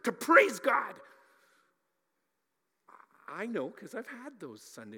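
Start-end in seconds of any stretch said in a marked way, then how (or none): 0.0-1.0 put-on furious shout at the kids, much higher and louder; exasperated parent yelling, strained and high; angry parent shout. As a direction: lose it at the screaming kids in the car. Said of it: "You're like".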